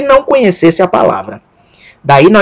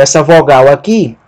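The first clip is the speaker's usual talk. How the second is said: ordinary talk, nothing marked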